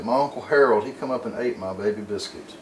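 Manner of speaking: ordinary talk, nothing marked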